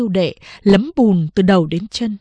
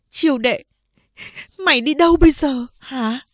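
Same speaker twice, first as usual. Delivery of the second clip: lightly voiced worried mother; 6 semitones higher than usual. Mother holding daughter back.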